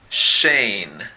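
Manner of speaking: "Shane" with a clear, hushing sh; English word demo. Shown as a demonstration. Teacher reading the chart word, careful demo.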